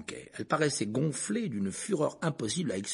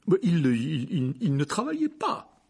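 flustered uncle, higher and halting; nervous stammer; 5 semitones higher, 4 dB louder, slower than usual. A narrator's reading. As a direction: stumble anxiously into the answer. Tense uncle caught off guard. (bafouillage nerveux, plus aigu)

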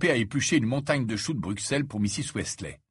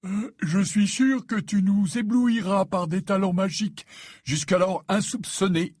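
slow, higher-pitched words through a yawn; 8 semitones higher, 3 dB louder than usual. A narrator's reading. Fred feigns interest in Ron's threat, yawning. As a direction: yawn your way through the sentence. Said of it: "dit Fred en bâillant".

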